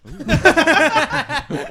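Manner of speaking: high, loud burst of laughter; big laugh. Real, not acted. Friend cracking up at the joke name, loud high laugh.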